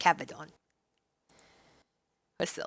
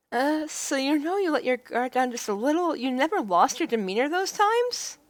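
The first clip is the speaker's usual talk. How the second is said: raised, probing voice; higher heroine voice, inquisitive; curious heroine; 5 semitones higher than usual. Acted. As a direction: coax it out of him.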